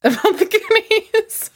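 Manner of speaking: high-pitched, drawn-out laughing echo; laughing disbelief. Real, not acted. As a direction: repeat it through helpless laughter. Laughing at cohost's lingering hurt.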